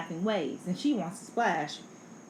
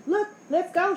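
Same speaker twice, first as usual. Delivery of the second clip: high, louder excited call; rally a friend with a raised call; shouting; 8 semitones higher, 5 dB louder than usual. A narrator's reading. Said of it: "shouts Ben's".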